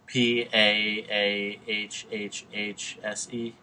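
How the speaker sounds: "P A A H H H S E" spelt slowly with drawn-out letters; slow mocking spell-out. Shown as a demonstration. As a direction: spell out the stretched vowel slowly, teasing. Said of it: "It's my New York accent".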